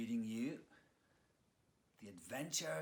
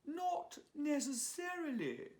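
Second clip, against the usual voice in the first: much higher, slow, sly posh English. Acted. Honey answers Leon with a slow, high posh English hint of a plan. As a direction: savour it like a scheming actress. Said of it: "a very posh English accent".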